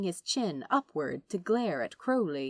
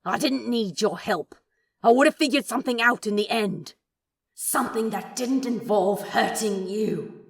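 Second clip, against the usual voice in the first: angry; 4 semitones higher, 7 dB louder than usual. Acted. Crowley argues with angel.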